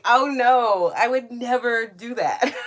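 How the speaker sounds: loud, high, emphatic refusal; high-pitched playful protest; amused mock refusal. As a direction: refuse in exaggerated horror, on the edge of laughter. Imagined refusal, reacting with amusement.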